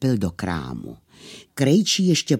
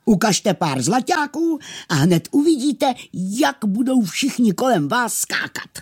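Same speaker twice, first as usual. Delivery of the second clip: high, amused miller's voice; 11 semitones higher than usual. Acted. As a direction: egg him on with amusement. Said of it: "zasmál se mlynář".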